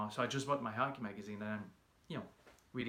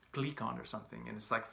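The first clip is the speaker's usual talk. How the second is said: ordinary talk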